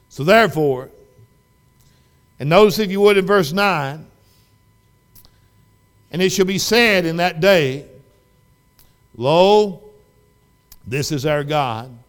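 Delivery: full-force preaching. Real, not acted. Preacher reading a verse to the congregation, loud preaching. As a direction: proclaim the scripture loudly to the whole church.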